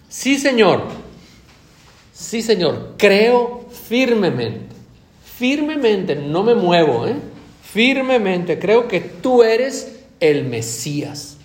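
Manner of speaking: loud, slow, rising declaration of faith; fervent confession. Acted. Believer to Jesus, fervent loud confession. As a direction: proclaim it with full conviction, slowly.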